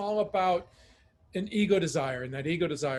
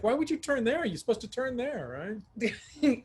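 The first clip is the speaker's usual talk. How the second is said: mock annoyed partner; 6 semitones higher than usual. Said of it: "It's like".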